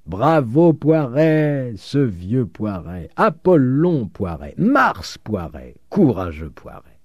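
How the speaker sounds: slow, drawn-out acclamation; hammed-up crowd bravos; mock cheering. A narrator's reading. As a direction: cheer him in stretched-out words. Crowd cheering amid laughter.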